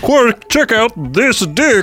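slow, loud, high puppet character; high-pitched Muppet voice. Shown as a demonstration. Podcaster demonstrates Morn to his co-host, high-pitched Muppet voice. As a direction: drag it out in a loud high cartoon voice. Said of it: "a very high-pitched, nasally voice".

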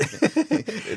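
a sudden high laugh at herself; real amused laugh; high burst of laughter. Real, not acted. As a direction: laugh it off, embarrassed. Forgot the fourth step.

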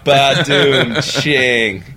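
vocal drum-and-cymbal punchline sting; imitated comedy drum hit; mock rimshot. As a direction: sing out a loud, drawn-out joke rimshot. Mock rimshot after a pun.

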